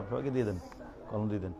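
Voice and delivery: low, hushed practical instructions off to the side; quiet aside. Real, not acted. Teacher tells a helper to hand things out, quiet aside. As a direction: drop well below classroom voice. Aside about handing out items.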